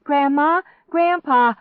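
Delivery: high, loud calls; crying out. A narrator's reading. Girl calls grandparents from stairs, crying out. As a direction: call frantically for rescue. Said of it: "I cried out".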